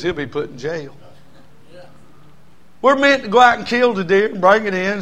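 ordinary talk

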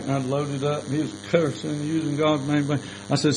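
ordinary talk

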